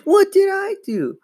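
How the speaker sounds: very high-pitched indignant wail; outraged. A narrator's reading. Sister unfairly threatened with punishment, outraged. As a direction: protest innocence loudly.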